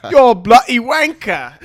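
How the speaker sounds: high, loud, drawn-out mock English accent. Host echoes guest's insult, mock English accent. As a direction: yell it slowly in a hammy English accent. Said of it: "when I try an English accent".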